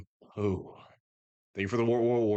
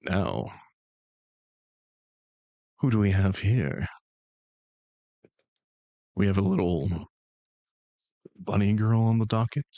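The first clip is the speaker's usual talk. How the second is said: presenter voice. Acted. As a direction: introduce the guest like a slightly grand host. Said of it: "I need good posture to do this voice".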